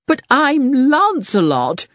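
high, wounded protest; indignant knight. Acted. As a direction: insist on your own greatness.

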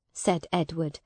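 usual speaking voice

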